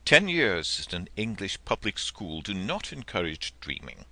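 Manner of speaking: ordinary delivery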